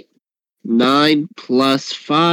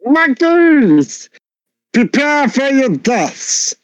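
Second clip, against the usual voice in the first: high, drawn-out mock-heroic cry; theatrical high-pitched warrior challenge; battle challenge; 11 semitones higher, much slower than usual. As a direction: declaim a threat in a high character voice. Talon challenges the monkeys in character.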